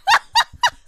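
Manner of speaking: very high, loud squealing laugh; shriek of laughter. Real, not acted. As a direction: burst out in a piercing cackle. Laughing at [name] joke.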